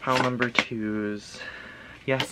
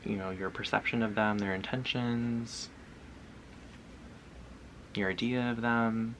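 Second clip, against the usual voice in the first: guided visualization; 4 dB quieter than usual. Acted. Guides viewer to picture person.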